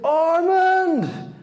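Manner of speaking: a high, desperate call for his son. Acted. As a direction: cry out the name into the rubble. Father calls for buried son.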